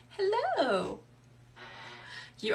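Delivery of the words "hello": high, delighted squeal of a hello; light up at a friend arriving; sing-song excited greeting. Real, not acted. Greeting a friend joining live.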